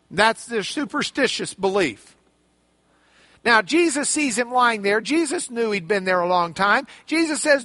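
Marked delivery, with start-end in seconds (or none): none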